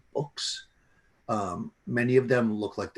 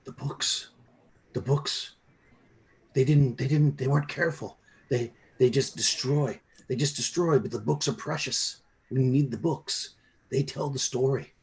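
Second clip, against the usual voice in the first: put-on high, strained, desperate old man's voice; cling to the books like they're your whole life; frantic old scholar; 5 semitones higher than usual. The mad old man answering the fighter, high and desperate.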